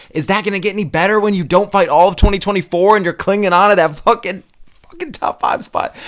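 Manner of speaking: high-pitched, frustrated rant; pissed off. Real, not acted. Fan ranting about an inactive fighter clinging to his ranking, pissed off. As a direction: vent in real exasperation, voice pushed up high. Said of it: "I get so pissed about this one".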